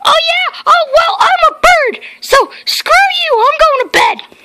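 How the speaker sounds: high-pitched cartoon voice, indignant; squeaky toy character demanding.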